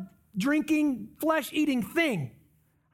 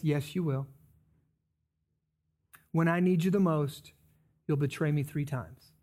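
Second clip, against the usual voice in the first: grave Jesus voice; 8 semitones lower than usual. Acted. Jesus answering Peter's boast, low and solemn.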